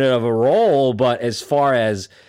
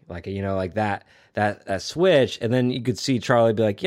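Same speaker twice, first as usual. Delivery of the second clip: ordinary talk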